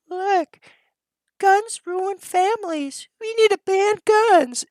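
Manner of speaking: high, slow mocking voice of a gun-hating mom; mocking wife voice. Streamer mocking moms who want guns banned, high sneering imitation. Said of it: "women running them will go".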